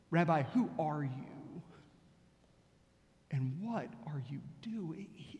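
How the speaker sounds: soft, slow, whispered ending; hushed slow questions. Acted. Preacher hushing the rabbi's two questions to the congregation, whispered. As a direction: let the questions sink in, barely voiced.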